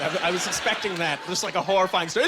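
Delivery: usual speaking voice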